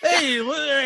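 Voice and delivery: high-pitched caricature voice; exaggerated, high imitation of a drunk; cartoonish Aussie drunk. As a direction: talk like a cartoon Australian drunk. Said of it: "everything was like this".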